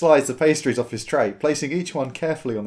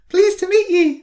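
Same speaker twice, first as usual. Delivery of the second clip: put-on much higher, louder girl's voice; a bright little girl greeting; dwarf girl greeting the visitor in a high child voice; 7 dB louder than usual.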